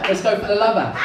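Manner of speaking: high, mock-exasperated wife saying "lavas". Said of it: "She says".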